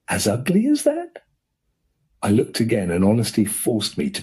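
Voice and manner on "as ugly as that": high, stunned question; can't believe it; dismayed surprise. Acted. Painter dismayed at verdict.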